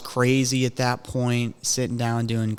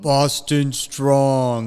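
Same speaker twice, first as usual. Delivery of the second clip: slow, drawn-out slogan; ponderous mock rallying cry; 4 dB louder, much slower than usual. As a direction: stretch both words out derisively. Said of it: "when you say it like that".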